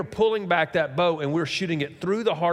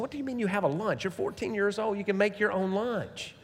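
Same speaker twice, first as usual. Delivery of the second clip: higher, softer exasperated retort; incredulous dad; 5 semitones higher, 6 dB quieter than usual. Retold or imitated. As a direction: scold a teen in disbelief, pitched up. Dad's incredulous retort to teenage son.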